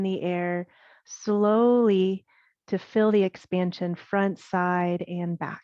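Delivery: unhurried yoga breathing guidance with long pauses; guided meditation. Acted. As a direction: guide them through the breath, leaving space between phrases. Leads guided breath practice.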